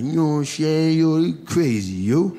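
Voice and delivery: mildly voiced upset teenager; a hint of a street-talking kid, worked up; upset teen. Pajama kid complaining to teacher, lightly voiced teen. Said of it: "He's like".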